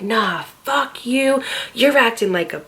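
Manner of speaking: imitating the drunk boyfriend cursing her out; retold yelling. Drunk boyfriend cursing her out at the party, mock yell. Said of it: "like, yelling".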